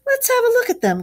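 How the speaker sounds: high, loud eager cry; excited cry. A narrator's reading. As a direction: call it out, eager to see the visitors. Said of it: "cried the rat".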